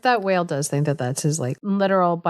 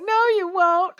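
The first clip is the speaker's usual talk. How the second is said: high, whimpering no; hammed-up boy refusing, mock crying; 13 semitones higher than usual. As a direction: fake a scared sob.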